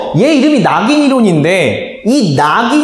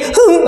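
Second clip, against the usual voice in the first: mock fart noise; much slower than usual. 조용한 수업 중 방귀 흉내. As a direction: squeak out a fart in silent class.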